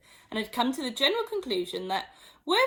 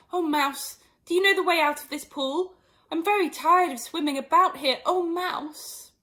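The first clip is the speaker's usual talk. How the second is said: higher, louder polite plea; formal address; 7 semitones higher, 5 dB louder than usual. Acted. Alice formally calling to the mouse. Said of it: "the right way of speaking to a mouse".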